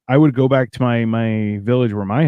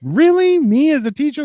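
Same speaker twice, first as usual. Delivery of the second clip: higher, incredulous squeak; playful raised-pitch doubt; mock disbelief; 13 semitones higher than usual. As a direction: act amazed that anyone picked you. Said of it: "'cause you're just like".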